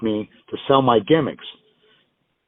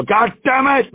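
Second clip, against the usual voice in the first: high-pitched imitated cursing; imitating a friend's frustrated yelp; mock exasperated outburst; 12 semitones higher, slower than usual. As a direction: cry it out high, comically fed up. Repeats friend's outburst before sponsors.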